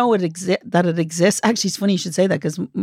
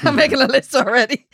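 laughing; 7 semitones higher than usual. Real, not acted. Repeating joke louder with laugh.

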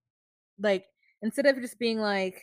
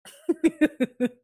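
laugh; 6 semitones higher, 8 dB louder than usual. Real, not acted. Laughing at own joke.

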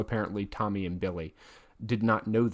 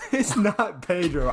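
laughing insistence; 7 semitones higher, 8 dB louder than usual. Real, not acted. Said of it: "jokingly insisting on the name".

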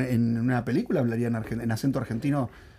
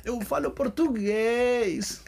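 high, stretched-out Brazilian croon; milk the Brazilian accent slowly, pitched well up; playful Brazilian Portuguese accent; 8 semitones higher, much slower than usual. Pretend. Showing off Portuguese for laughs, hammed-up Brazilian accent.

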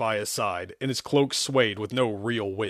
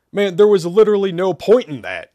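higher, louder griping; whiny complaint; 8 semitones higher, 9 dB louder than usual. Acted. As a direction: complain like a bored teen. Joe complaining loudly.

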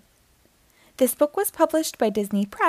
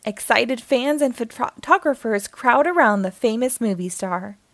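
ordinary delivery, nothing marked

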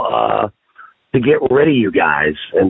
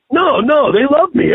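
naive denial; 13 semitones higher, slower than usual. Retold or imitated. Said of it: "he's like".

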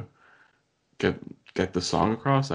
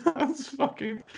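high squealing laugh through words; laughing hard; 4 dB quieter, slower than usual. Real, not acted. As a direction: crack up mid-sentence. Laughing at read comment.

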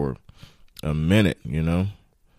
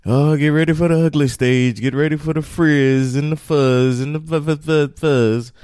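teasing friends; 9 semitones higher, 6 dB louder than usual. Retold or imitated. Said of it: "everybody that has locks or had locks was like".

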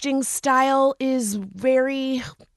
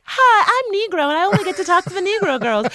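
chirpy voice pitched much higher; mock cheerful character; 9 semitones higher, faster than usual. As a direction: play the eager new hire, overly bright. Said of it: "you wouldn't be like".

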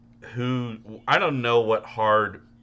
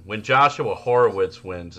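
ordinary delivery

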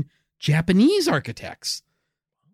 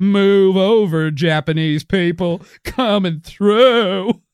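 slow, higher honking mock driver; silly clown voice; 4 semitones higher, 4 dB louder, slower than usual. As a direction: barrel through like a goofy clown. Riding a rascal through Japan.

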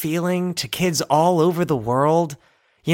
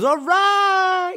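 much higher, yelled exclamation; mock yell; 13 semitones higher than usual. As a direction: shout it as you bolt. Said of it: "he goes".

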